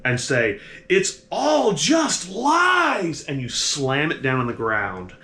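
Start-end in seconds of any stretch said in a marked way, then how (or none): 0.9-3.2 high, drawn-out cry of despair; loud, slow, high-pitched dramatic outburst; despairing shout. Acted. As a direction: stretch the words out in an acted, anguished yell. Game master acts out despairing outburst.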